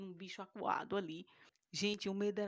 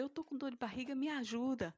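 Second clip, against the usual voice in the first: higher and louder, urgent plea through the door; pleading for help; 6 semitones higher, 5 dB louder than usual. Retold or imitated. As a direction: beg him for help. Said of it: "E eu falei". (súplica urgente através da porta)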